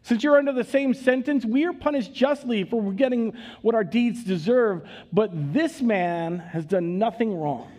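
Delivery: rebuking. A narrator's reading. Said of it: "the other criminal rebuked him".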